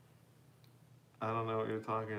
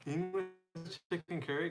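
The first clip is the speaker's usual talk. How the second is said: much higher, disbelieving echo; incredulous; 6 semitones higher than usual. Real, not acted. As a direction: say it like it can't be real. Repeats the puzzling dish name.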